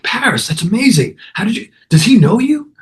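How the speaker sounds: very high, impressed mock astonishment; amazed. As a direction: imitate a friend marveling at your connections. Jerry astonished bouncer knows him.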